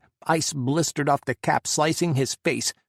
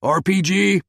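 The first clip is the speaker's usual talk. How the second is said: loud, higher holler; 6 semitones higher, 7 dB louder than usual. A narrator's reading. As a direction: bellow the warning. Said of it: "he hollered".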